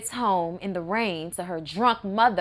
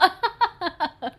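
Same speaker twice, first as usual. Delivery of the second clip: giggle; 8 semitones higher than usual. Acted. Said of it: "They giggle".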